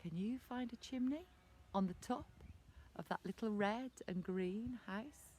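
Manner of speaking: ordinary delivery, nothing marked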